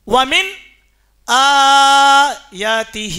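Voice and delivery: آیَاتِهِ recited with a long, loud held opening "aa"; slow Quranic madd on وَمِنْ آیَاتِهِ; elongated madd. Shown as a demonstration. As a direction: stretch the first vowel of آیَاتِهِ, then draw out the ending. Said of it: "اس کو کھینچا".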